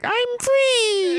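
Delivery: squeaky high cry of joy; Roulette voice. Retold or imitated. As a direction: shriek it like a freed cartoon sidekick. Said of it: "In that voice".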